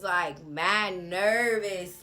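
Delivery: normal talking voice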